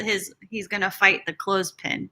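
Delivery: ordinary delivery, nothing marked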